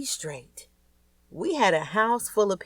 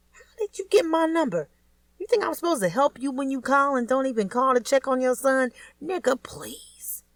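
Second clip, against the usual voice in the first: indignant; 8 semitones higher than usual. A narrator's reading. Kelly telling off her son's absent father, indignant. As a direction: put the deadbeat ex in his place. Angry at ex on phone.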